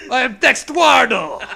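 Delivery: hammed-up Mexican accent; mock Mexican accent. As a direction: announce it like a cartoon bandido. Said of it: "our Dexter voice ... Mexican stereotype".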